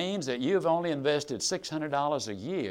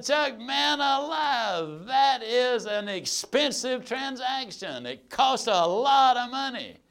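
shocked protest; 7 semitones higher, slower than usual. Retold or imitated. Reacting to the car price.

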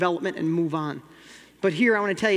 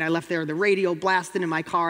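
ordinary talk

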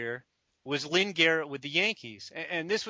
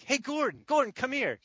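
high-pitched beckoning; pitched-up "come over here" call; imitated calling over; 8 semitones higher than usual. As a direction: act out summoning a colleague across the office. Retold calling a colleague over.

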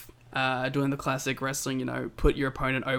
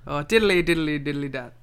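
mock Irish; 4 dB louder than usual. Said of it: "attempt it".

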